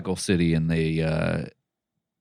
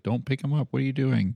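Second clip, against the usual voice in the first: higher-pitched retold protest; mock freaking out; 7 semitones higher than usual. Warning driver off a pickup, mildly freaking out. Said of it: "they're freaking out".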